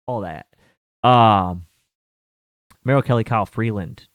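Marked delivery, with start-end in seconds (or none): none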